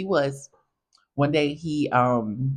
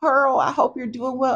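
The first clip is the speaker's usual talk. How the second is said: mock crying; 10 semitones higher than usual. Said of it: "And this man started crying".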